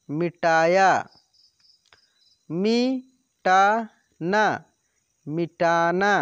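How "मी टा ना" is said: ना and रा stretched apart, louder; very slow syllables. Shown as a demonstration. Teacher sounding out syllables for pupils, very slow. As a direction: spell it out slowly, syllable by syllable. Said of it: "direct आपको बोलना है कि".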